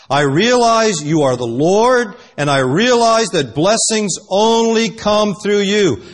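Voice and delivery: loud, slow, high-pitched declaration; emphatic plea. Acted. Jacob realizing who he wrestles, loud emphatic plea. Acts Jacob pleading with God.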